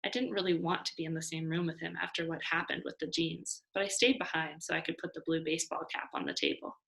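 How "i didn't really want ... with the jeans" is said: ordinary delivery, nothing marked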